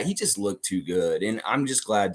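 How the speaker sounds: usual speaking voice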